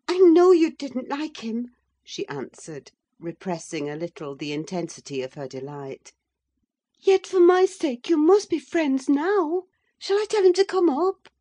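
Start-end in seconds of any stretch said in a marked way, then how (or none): 0.1-1.8 high, loud and eager; delighted. A narrator's reading. Delighted wife. 7.0-11.3 high, loud and eager; delighted. A narrator's reading. Delighted wife pleading.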